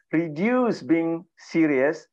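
usual speaking voice

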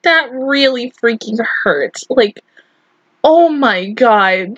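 usual speaking voice

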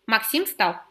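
usual speaking voice

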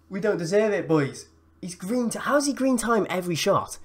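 high-pitched disbelief; exasperated complaint. Real, not acted. Gamer complaining about perfectly timed shots, exasperated.

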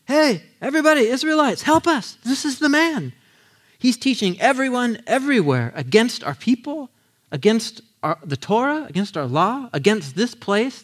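angry crowd shouting. Acted. Temple crowd calling everyone to seize Paul, outraged shout. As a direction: rouse everyone against this man.

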